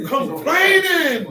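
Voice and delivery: slow, high, laughing shout of scorn; high drawn-out jeer; mocking laugh. Real, not acted. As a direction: stretch it out, laughing at them.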